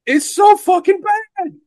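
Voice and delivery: drawn-out, high-pitched disgust; slow, rising howl of complaint; exasperated rant. Real, not acted. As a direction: wail it slowly in sheer exasperation. Ranting about hated, overpraised movie.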